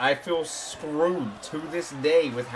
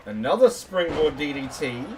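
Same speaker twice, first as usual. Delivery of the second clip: wrestling commentator; 4 semitones lower, 6 dB quieter than usual. Acted.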